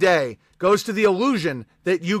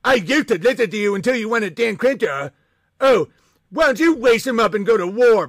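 higher, put-on whiny voice; mocking critic; 3 semitones higher, 3 dB louder than usual. Streamer mocking an ex-fan angry about Dan Crenshaw. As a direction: parrot the ex-fan snidely. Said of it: "If someone's like".

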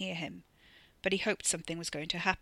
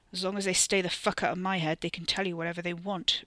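ordinary delivery, nothing marked

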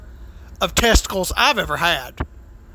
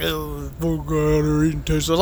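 eye-rolling scorn; 6 semitones lower, 3 dB quieter than usual. Retold or imitated. Waiter scorning diner's order of mountain oysters, eye-rolling mockery. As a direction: imitate him with a weary eye-roll. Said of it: "you gotta fucking roll your eyes like".

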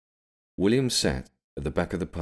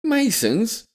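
high, rising shout, building louder; incredulous shout; 6 dB louder, much slower than usual. A narrator's reading. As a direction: shout it in outraged disbelief, pitch leaping up. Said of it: "pointing towards William and shouting".

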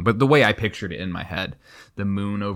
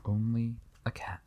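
hushed echo; 3 semitones higher, 10 dB quieter than usual. Acted. Co-host echoing the story's reveal, hushed. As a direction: murmur it like a closing line. Echoing the reader's last line.

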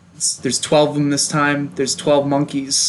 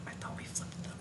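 soft unvoiced whisper; 27 dB quieter, faster than usual. Real, not acted. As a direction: whisper it so the mic barely catches it. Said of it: "Why are you whispering?".

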